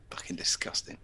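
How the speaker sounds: whispered under breath; whispered aside. Real, not acted. Muttered aside during reading.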